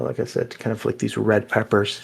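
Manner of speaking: ordinary delivery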